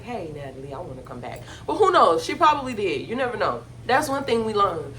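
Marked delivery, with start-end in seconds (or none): 0.0-1.4 much lower and much softer, a timid little plea; meek Krishan. Retold or imitated. Imitating Krishan calling Natalie, meek and hushed. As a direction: shrink your voice into a sheepish ask.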